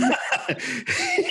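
high cackling laugh breaking the story; burst of laughter. Real, not acted. Narrator cracking up retelling the gangbanger scene, high laugh.

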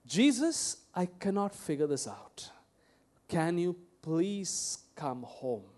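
hushed desperate whisper, slow at the end; pleading whisper. Retold or imitated. Rich man begging Jesus to come home, whispered plea. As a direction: beg in a breathless hush.

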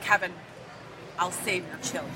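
mock-sad reassurance. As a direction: say it softly, with long pauses, as mock comfort. Mock condolence addressed to Kevin.

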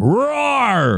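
high drawn-out roar; roar demo. Shown as a demonstration. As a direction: lead him with a big roar. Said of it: "match my volume".

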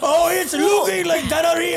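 high, exclaimed mock-Scottish; botched Scottish accent, mimicked. Mocking a friend's attempt, exaggerated high Scottish accent. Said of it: "Why did you go".

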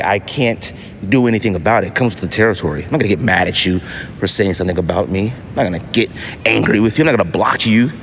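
ordinary delivery

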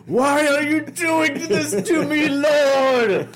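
high, slow wail to the heavens; mock anguished cry. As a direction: plead with God in mock despair. Mock lament to the Lord.

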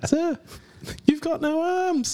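high, slow put-on voice for a movie line; camping up a film quote for friends, in a high slow voice; mock movie quote. Quoting movie line in character voice.

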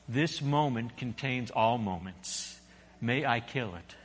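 low, soft, steady angel voice; a quiet, unhurried angel; calm angel. A narrator's reading. Angel speaks.

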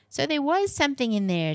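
high-pitched, pleased exclamation; cheering. A narrator's reading. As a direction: lift the pitch in a contained cheer. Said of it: "cheered Libby".

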